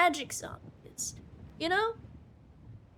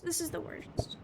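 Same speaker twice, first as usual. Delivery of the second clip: whispered complaint; 5 dB quieter, faster than usual. Real, not acted. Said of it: "I ran out of tissues".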